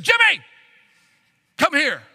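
sharp high yell of the name, cutting off; mother summoning her son in anger, shouting; angry mother's shout. Retold or imitated. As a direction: call the kid like he's in trouble. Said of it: "she spoke with a different tone".

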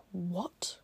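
whispered disbelief; a hushed, stunned what. Retold or imitated. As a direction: breathe it out in shock. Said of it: "Kim Ji-young is like".

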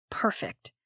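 soft breathy whisper. Acted. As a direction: whisper it to yourself. Nyx finds her hiding spot.

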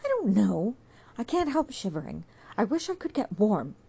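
cold and miserable, begging warmth; shivering girl. Acted. Sue tells Jude she can't stop shivering, miserable.

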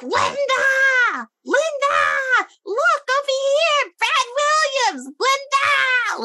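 high-pitched, drawn-out mock screech; hammed-up New Yorker screaming for a friend; imitated screaming. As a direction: holler across a room like an obnoxious stranger. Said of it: "Like, screaming".

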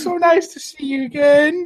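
high, sweet barmaid voice; sweet innkeeper. Acted. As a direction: greet him like an old flame. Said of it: "And she goes".

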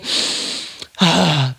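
deep audible demonstration breath; breathing exercise. Shown as a demonstration. Mother showing her daughter how to breathe, deep audible breath. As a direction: take one slow breath to show how. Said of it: "We breathe in".

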